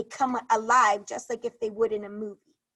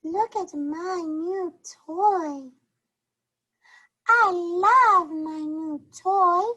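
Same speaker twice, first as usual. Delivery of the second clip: higher, slow, proud character voice; 6 semitones higher, 4 dB louder, much slower than usual. Shown as a demonstration. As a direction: savour each word like a happy storybook character. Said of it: "show you how that sounds like".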